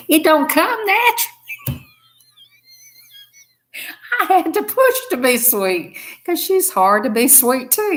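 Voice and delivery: laughing. Real, not acted. Laughing about having to be sweet.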